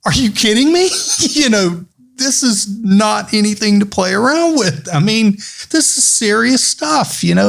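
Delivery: high, disbelieving outburst; can't believe what you hear; incredulous. Retold or imitated. Own retold incredulous reply.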